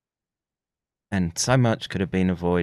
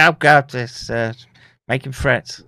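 mock outrage; 7 semitones higher, 10 dB louder than usual. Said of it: "they'll say".

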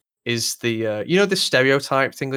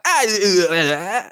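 loud, high garbled noises, hammed-up; mock your own unintelligible speech; incoherent mumbling imitation; 8 semitones higher, 5 dB louder, slower than usual. Speaker mocking how people can't understand his accent, hammed-up garbled noises. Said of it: "so when I'm like".

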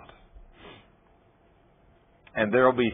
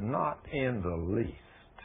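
calm; 9 dB quieter, much slower than usual. Retold or imitated. Calm unconcerned reply.

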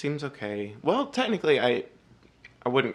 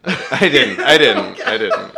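loud, laughing admission; real laughter breaking through the words; laughing denial; 2 semitones higher, 14 dB louder, slower than usual. Real, not acted. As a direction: crack up while owning up. Admits joke while cracking up.

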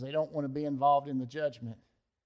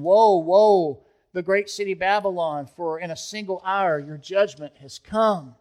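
woe cried out much louder, then easing; loud lament; 7 dB louder than usual. Retold or imitated. Kings and merchants lament the great city, crying out loud. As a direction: wail the woe like a public lament. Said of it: "the voice of lamentation".

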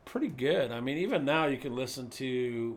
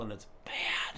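whisper; 4 semitones lower, 5 dB quieter than usual. Pretend. Listing naughty, evil, bad.

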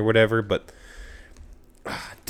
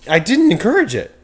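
high, loud denial; indignant protest; 10 semitones higher, 7 dB louder than usual. Real, not acted. Host denying he encouraged the accent, loud and indignant. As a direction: protest at a much higher pitch.

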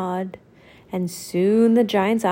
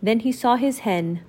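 usual speaking voice

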